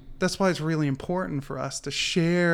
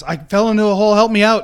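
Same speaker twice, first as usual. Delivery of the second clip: higher and louder, calling up out of the hole; shouting for help; 7 semitones higher, 10 dB louder, faster than usual. Retold or imitated. Man in the hole shouting to the policeman, louder plea. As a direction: yell it up to the policeman above. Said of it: "And he goes".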